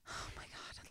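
whispered oh my God in panic; panicked whisper. Retold or imitated. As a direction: breathe it out as an unvoiced whisper. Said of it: "So it was like".

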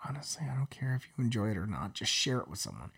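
hushed aside; whispering. Real, not acted. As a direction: drop to a whisper. Confiding aside to listeners.

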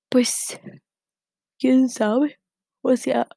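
words stretched by a yawn; talking through a yawn. Real, not acted. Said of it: "bostecé".

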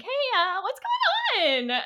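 high, drawn-out pleading call; hammed-up worried boyfriend, sing-song and slow; mock fiance on the phone. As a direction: stretch it out in a silly high voice. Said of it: "keeps trying to call her, like".

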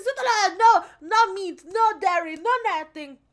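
high, loud and excitable French chef. Retold or imitated. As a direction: squeal the refusals like a fussy chef. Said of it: "He's like".

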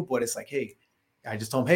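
ordinary talk

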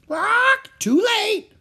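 high loud squawking parrot. Acted. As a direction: screech it like a bird. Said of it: "squawked Polly".

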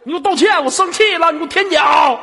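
high, loud demand; hammed-up furious ordering; mock anger. Host demanding apology from caller, mock-angry.

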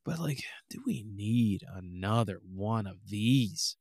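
slow, hushed whisper; whispered aside. Real, not acted. Questioning yet another open world game, slow whisper. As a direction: lean in and whisper the doubt. Aside against flashy sequels.

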